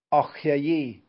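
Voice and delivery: loud cry of dismay; dismayed exclamation. A narrator's reading. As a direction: call it out loudly, dismayed. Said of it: "rief Brausewein".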